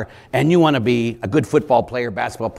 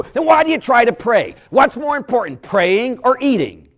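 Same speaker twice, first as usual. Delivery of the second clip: high, insistent loud questioning; mock exasperated challenge; 11 semitones higher, 5 dB louder than usual. Preacher demanding an answer about prayer, high exasperated challenge. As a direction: press the point home, voice lifted. Scolding builds to the point.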